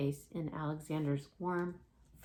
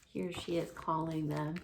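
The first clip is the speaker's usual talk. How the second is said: ordinary delivery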